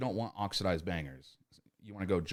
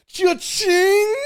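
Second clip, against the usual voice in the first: much higher and louder, shouted cash-register cry; gleeful cha-ching; 19 dB louder than usual. Retold or imitated. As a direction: yell it like you just made the sale. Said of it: "You're like".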